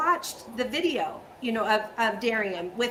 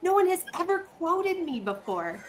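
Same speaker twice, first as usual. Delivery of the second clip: retold surprise; 5 semitones higher than usual. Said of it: "I was like".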